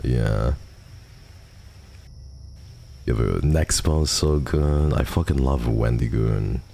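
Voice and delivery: ordinary talk, nothing marked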